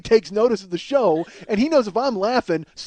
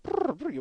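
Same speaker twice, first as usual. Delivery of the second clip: higher trilled "brr"; mimicked rubber dove flutter; imitated dove noise; 4 semitones higher, 8 dB quieter than usual. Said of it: "it's like".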